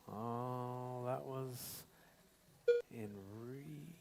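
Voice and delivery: usual speaking voice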